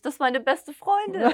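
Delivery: high, loud burst of amazement; retold cry of amazed recognition; astonished exclamation. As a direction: exclaim it in disbelief, drawing it out. Retelling her astonished discovery of a coincidence.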